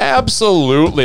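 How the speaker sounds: high, loud self-mocking "Absolutely."; hamming up his own pause word; mock commentator. Guest mocking his own commentary habit, high and loud. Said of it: "I'm like".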